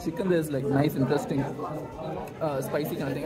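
chewing between words; talking around food; mouth full. Real, not acted. Seen 2: Bite taken at -4.0; closed-lip jaw movement between words, fork in hand.

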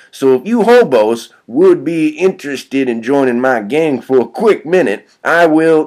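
put-on pushy, emphatic kid; cocky kid recruiter. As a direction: sell it hard like a gang recruiter.

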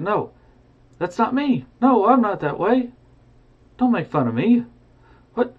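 put-on high, pleading old man voice; a wounded miser begging them to stop mocking; hurt protest. Scrooge mocked at party.

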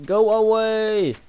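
high, long-drawn "go away"; exasperated call. Real, not acted. Player telling a pursuer to go away, exasperated call.

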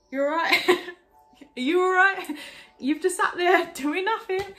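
high, loud, laughing disbelief; incredulous outburst. Retold or imitated. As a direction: call out loudly at a useless onlooker, half laughing. Teasing retold outburst at partner.

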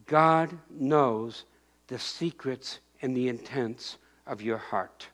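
ordinary delivery

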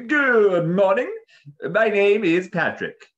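loud, high, slow formal greeting; composed parrot Patrick. A narrator's reading. Parrot introducing himself to the beast, grandly composed. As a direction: proclaim it grandly, stretching the words. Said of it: "taking a moment to compose himself".

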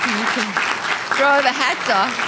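calling out. Real, not acted. Calling out to graduates.

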